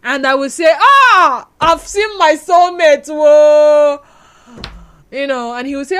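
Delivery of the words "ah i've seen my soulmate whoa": восторженное узнавание. Acted. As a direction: exclaim it like a swooning movie heroine. Acts out finding her soulmate.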